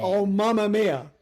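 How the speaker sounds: mock Italian exclamation; Jared Leto's accent. Host mimicking the actor's film role, mock Italian accent. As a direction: put on a stagey Italian accent. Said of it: "You then have Jared Leto".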